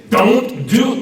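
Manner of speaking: loud, high, heavily stressed parental warning; emphatic warning. Shown as a demonstration. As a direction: hammer each word like a parent forbidding a child. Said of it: "maybe you're not quite that emphatic".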